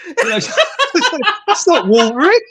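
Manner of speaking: amused laugh mocking the cartoon. Real, not acted. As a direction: get the words out between laughs.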